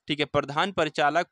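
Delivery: ordinary talk, nothing marked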